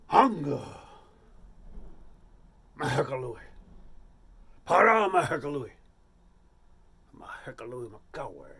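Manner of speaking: loud guttural caveman chant; caveman chieftain. Retold or imitated. John auditions as caveman chieftain, loud grunting chant. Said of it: "you are the chieftain of prehistoric tribe".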